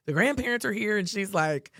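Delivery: ordinary talk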